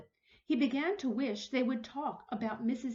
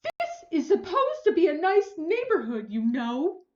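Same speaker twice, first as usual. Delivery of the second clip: higher, louder huffy threat; indignant threat; 7 semitones higher, 10 dB louder than usual. Acted. Huffy neighbor calling back her threat while storming off. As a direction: threaten loudly while leaving. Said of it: "she called back over her shoulder".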